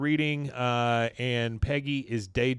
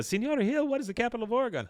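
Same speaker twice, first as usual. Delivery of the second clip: put-on high, fast little-kid question; kid's voice; 12 semitones higher, much faster than usual. Kid trying to get teacher's attention, high child voice. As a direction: pipe up like a schoolchild. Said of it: "one of the kids".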